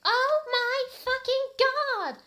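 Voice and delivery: high, loud, slow drawn-out squeal; scream. Acted. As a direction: stretch out the thrilled scream. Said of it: "I screamed".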